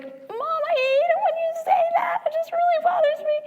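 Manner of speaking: put-on very high, frustrated teen whine; dog-whistle soprano daughter. Mother imitating her frustrated daughter, very high and whiny. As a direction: squeak it out like an exasperated soprano daughter. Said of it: "her voice sounds like a dog whistle when she gets frustrated".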